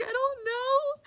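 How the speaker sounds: very high, slow, crying whine; whiny helpless. Retold or imitated. Answering grandma's pressure, high whimpering whine. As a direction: whimper it like a cornered kid.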